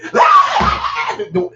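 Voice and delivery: a high, loud burst of laughter; loud shrieking laugh. Real, not acted. Mother laughing loudly at her kids' fuss.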